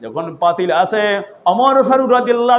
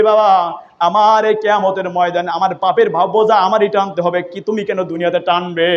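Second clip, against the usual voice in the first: ordinary delivery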